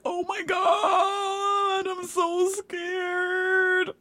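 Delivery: high, drawn-out mock-terrified wail; scared wail. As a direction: stretch it out in a high, thin whine of fear. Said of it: "I can feel it right now".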